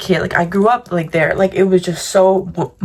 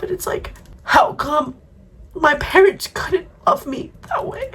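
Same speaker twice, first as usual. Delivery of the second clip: crying; 7 semitones higher than usual. Real, not acted. Crying about parents.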